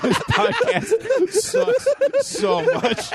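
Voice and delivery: high, slow, cracking up; laughing. Real, not acted. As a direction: talk while laughing hard. Laughing through words.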